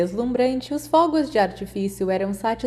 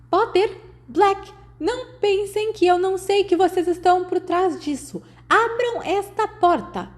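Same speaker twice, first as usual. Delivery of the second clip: strident McGonagall; 11 semitones higher than usual. A narrator's reading. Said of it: "a voz estridente de McGonagall". (McGonagall estridente, bem aguda e lenta)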